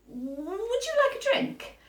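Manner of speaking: high, slow polite offer; mummy's high polite question; mummy offering politely. A narrator's reading. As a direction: offer it sweetly and slowly. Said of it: "Sophie's mummy said".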